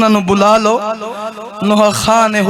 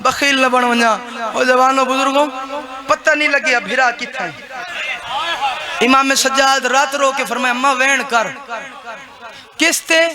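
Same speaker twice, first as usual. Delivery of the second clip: wailing lament chant, building in waves; keen the elegy to the mourners; mourning recitation; 3 semitones higher, 4 dB louder than usual. Acted. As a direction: zakir reciting masaib, weeping and chanting.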